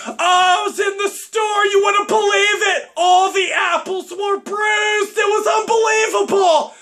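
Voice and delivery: high, loud mock shout of a phone chatterer; loud phone talker. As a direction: ham up a shrill-loud phone gossip. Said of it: "It's gotta be super loud".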